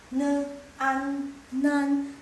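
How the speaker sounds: "Ne, an, nan" high and level, separated; sounding out syllables. Shown as a demonstration. Teacher repeats the combination, high level sounding-out. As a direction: blend "Ne, an" into "nan". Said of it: "ditambahin dengan konsonan an".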